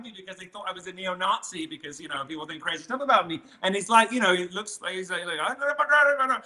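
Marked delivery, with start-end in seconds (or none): 5.4-6.4 mock Indian subcontinent accent, pitched higher; lightly imitating a stranger with an Indian subcontinent accent. Stranger on a Detroit street answering, in a mock Indian subcontinent accent. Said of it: "I went a bit Indian subcontinent there".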